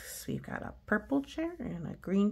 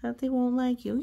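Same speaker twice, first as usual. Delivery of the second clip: ordinary delivery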